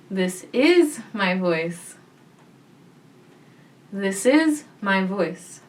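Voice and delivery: slow, with "is" lifted and leaned on; stress on is. Shown as a demonstration. Voice teacher demonstrating different emphasis, stress on "is". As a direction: lean on the word "is". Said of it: "let's try emphasizing different words".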